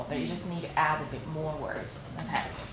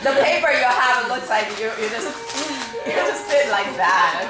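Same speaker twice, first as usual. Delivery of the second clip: laughing; 4 semitones higher, 6 dB louder than usual. Real, not acted. Laughing at the messy paper.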